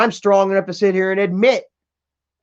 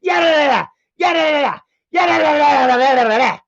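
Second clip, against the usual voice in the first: high mock screaming; imitating her screaming; 7 semitones higher than usual. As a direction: shriek it out again. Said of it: "like, three times".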